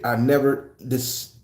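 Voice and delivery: ordinary talk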